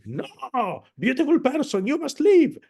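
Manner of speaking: wrong loud version. Shown as a demonstration. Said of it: "what is the likelihood of saying".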